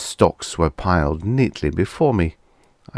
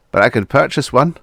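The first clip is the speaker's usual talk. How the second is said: brightened; 7 semitones higher, 5 dB louder than usual. A narrator's reading. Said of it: "Then he brightened".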